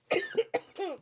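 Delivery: hard cough, still choking. Real, not acted. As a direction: cough it out, throat irritated. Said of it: "j'ai avalé de travers".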